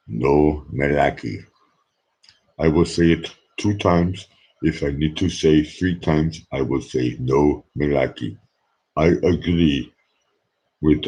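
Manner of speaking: put-on deliberate Austrian accent with weighty pauses; Arnold Schwarzenegger. Endorsing a campaign slogan as the ex-governor, in an Austrian accent. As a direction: put on a movie-star Austrian accent, phrase by phrase. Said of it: "I can do the Arnold Schwarzenegger Austrian accent".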